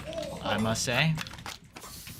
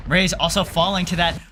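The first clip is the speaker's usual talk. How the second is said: excited; 8 semitones higher, 9 dB louder than usual. Real, not acted. Caster hypes the play.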